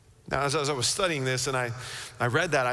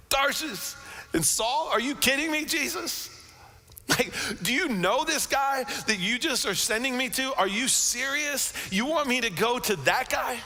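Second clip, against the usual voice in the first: high, disbelieving protest; are you kidding me, pitched up high; incredulous laughing protest; 8 semitones higher than usual. Retold or imitated. Ananias protesting to Jesus, incredulous and high. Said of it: "And he starts to laugh".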